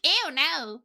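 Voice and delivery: hammed-up disgusted refusal; mock disgust. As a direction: imitate grossed-out viewers. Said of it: "they've gone like".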